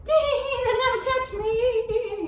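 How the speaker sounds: very high, slow gleeful cry; triumphant cry. A narrator's reading. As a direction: crow about it, sure you've won. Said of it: "he cried".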